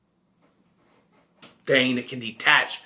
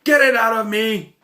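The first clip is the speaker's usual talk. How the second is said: raised, high-pitched yell; retold yelling; 7 semitones higher, 6 dB louder than usual. Movie reviewer acting out the pregnant woman, yelling. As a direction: yell it out as her panicked plea. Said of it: "She's yelling at him".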